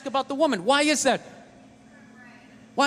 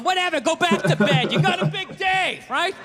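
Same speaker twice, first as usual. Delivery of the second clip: mock dismissive wife; 6 semitones higher than usual. Mocking wife's dismissive voice.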